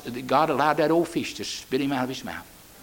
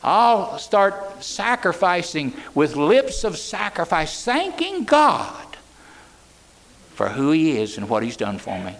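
Jonah's vow; slower than usual. Retold or imitated. Preacher voicing Jonah's vow of thanks, slow and drawn out. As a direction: stretch each word of the promise. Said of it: "He says".

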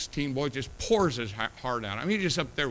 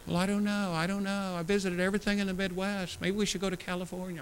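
daughter's voice; 6 semitones higher than usual. Retold or imitated. A hint of daughter coaxing dad. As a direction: imitate a coaxing girl. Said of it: "she just kept saying".

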